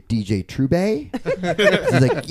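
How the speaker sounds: mock British "Trube", ending in an "ay" sound; British accent. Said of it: "say it in a British accent".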